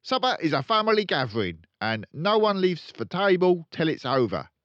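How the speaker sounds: loud, high, fast laying down the law; stern father. Acted. Father ordering Matilda, loud and stern. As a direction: bark the house rule.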